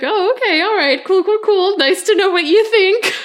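high, loud, playfully hurt; mock offended. Podcaster teasing co-host over an accidental insult, loud mock offence. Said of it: "it really sounded insulting. I'm like".

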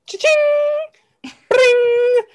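high loud cha-ching effect; cash register sound. Acted. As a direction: ring it like a till.

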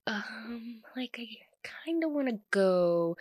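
hushed, timid little ask; shy whisper. Retold or imitated. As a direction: whisper it sheepishly, hoping for permission. Shy friend asking to leave.

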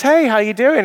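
high, loud breezy hello; cheery greeting. Retold or imitated. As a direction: toss it off brightly in passing. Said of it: "he says".

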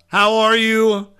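high bright hyped welcome; excited greeting. Real, not acted. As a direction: greet her with big energy.

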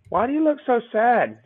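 high-pitched imitation of a small child; little girl. Imitating the officer's young daughter on FaceTime, childlike. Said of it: "his daughter is, like, four to six".

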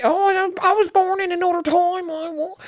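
put-on very high, shrill old Nan scolding; hammed-up granny nagging her grandson; old Nan teasing his tight pants; grandmother voice. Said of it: "they'll go on this whole rant".

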